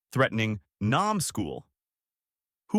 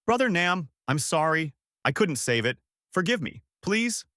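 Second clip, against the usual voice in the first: high-pitched anguished apology; mildly tearful pleading; understated sobbing; 6 semitones higher than usual. A narrator's reading. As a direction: beg forgiveness with a raised pitch.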